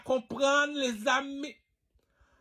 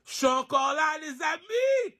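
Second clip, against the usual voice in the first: rising call; 6 semitones higher than usual. Real, not acted. Speaker telling friends he is still here, rising call. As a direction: call out, climbing high on the last word. Calls out to the audience.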